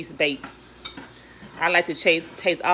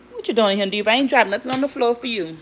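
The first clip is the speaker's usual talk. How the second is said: louder, higher teasing scold to a pet; talking to the dog; 4 semitones higher, 7 dB louder than usual. Real, not acted. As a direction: shoo the begging dog playfully. Talking to her dog Diva.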